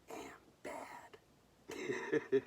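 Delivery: put-on high, loud, breathy tough-girl snarl; tough girl line. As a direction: toss it off like a cocky slasher girl. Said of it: "and she goes".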